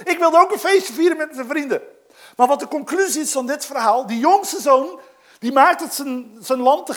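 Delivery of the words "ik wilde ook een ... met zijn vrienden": boos verwijt. Acted. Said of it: "in zijn boosheid".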